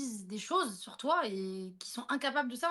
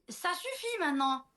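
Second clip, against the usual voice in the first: higher, slow, firm reprimand; stern telling-off; 6 semitones higher, 4 dB louder than usual. Retold or imitated. To the meowing cat, a slow stern rebuke. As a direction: lay down the law to the cat. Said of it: "Et j'ai crié".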